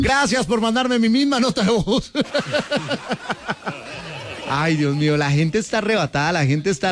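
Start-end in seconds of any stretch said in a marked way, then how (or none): 2.2-4.4 laughing. Real, not acted. Laughs at own voice note.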